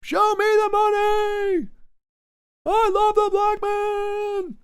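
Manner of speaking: high, slow, stretched shouted movie line; Jerry Maguire shouting. Retold or imitated. Streamer quoting the famous movie scene as a slow high shout. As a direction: bellow it like the famous phone scene. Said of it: "Jerry Maguire".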